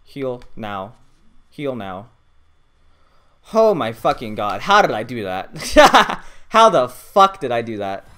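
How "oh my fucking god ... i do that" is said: loud, slow disbelieving exclaim; stunned excitement. Real, not acted. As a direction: shout in amazed disbelief. Disbelief after lucky survival.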